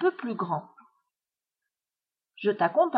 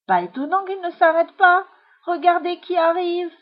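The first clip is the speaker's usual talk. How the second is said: high, loud warning; startled, raised-voice alert; alarmed; 6 semitones higher, 6 dB louder than usual. A narrator's reading. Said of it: "Soudain, Jean-Claude blêmit".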